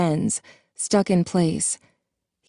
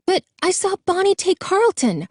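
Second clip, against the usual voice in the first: much higher desperate cry; frightened cry; 14 semitones higher than usual. A narrator's reading. Jason crying to Marla as she pushes him out, high frightened cry. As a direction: cry out, pleading to be believed. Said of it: "he cried".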